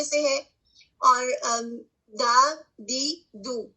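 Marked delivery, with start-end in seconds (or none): 2.1-3.7 دا دی دو said very slowly, syllable by syllable; dal with vowels. Shown as a demonstration. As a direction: stretch each of دا دی دو. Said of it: "same طریقہ".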